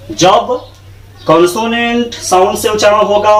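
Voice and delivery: ordinary delivery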